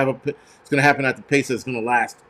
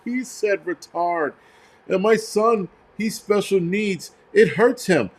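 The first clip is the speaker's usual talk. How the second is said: higher-pitched whiny parody of an upset parent; mock crying complaint; 5 semitones higher, slower than usual. Upset parent complaining about a word said, mock crying. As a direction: drag it out in mock distress. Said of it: "people are fucking crying and carrying on".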